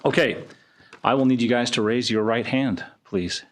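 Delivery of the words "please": usual speaking voice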